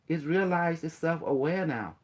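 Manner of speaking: ordinary talk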